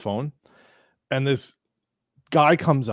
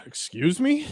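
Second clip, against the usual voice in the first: much higher, rising mock-offended question; taken aback; 10 semitones higher than usual. Narrator replies to insult, taken aback and high. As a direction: react incredulously, pitch shooting up.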